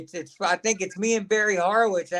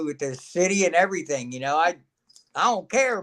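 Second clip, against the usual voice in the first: ordinary delivery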